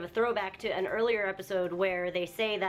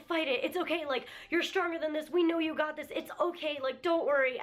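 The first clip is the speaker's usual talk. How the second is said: pleading friends; 6 semitones higher than usual. Retold or imitated. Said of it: "Yuriusu and Ferisu are both like".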